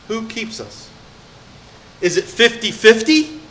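high, loud, driving preacher voice; fired-up preaching. Acted. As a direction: preach it out to the whole room. Preaches with rising force.

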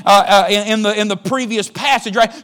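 loud, high, fervent preaching; preaching at full force. Acted. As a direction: preach it out with fire.

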